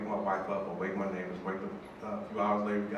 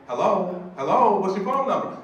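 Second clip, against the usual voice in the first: imitated woman calling down the line, higher and louder; raised, insistent mock phone voice; impatient phone lady; 7 semitones higher, 7 dB louder than usual. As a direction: prod the silent caller loudly. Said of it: "Caesar's very different than Miss Ruthie".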